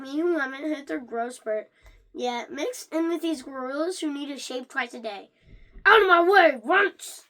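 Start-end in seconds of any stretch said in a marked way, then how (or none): 5.9-7.2 loud, higher bully yell; bully shout. Acted. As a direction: bark it like a big middle-school brute. Big kids shoving small kids.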